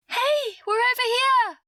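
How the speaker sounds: high, loud shout for rescue; frantic calling out. A narrator's reading. Stranded girl hailing a distant helicopter, frantic high shout. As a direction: yell to be spotted from afar.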